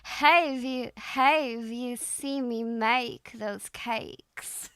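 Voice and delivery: very slow, stretched Southern drawl; Southern accent attempt. Shown as a demonstration. Student retrying the coach's sentence, slow Southern drawl. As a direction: work through the Southern glides slowly. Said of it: "it'll sound something like this".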